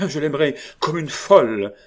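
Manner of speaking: high, loud passionate cry. A narrator's reading. Marguerite provoking Joulou, loud passion. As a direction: fling it out madly. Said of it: "en un élan qui devait être la passion même".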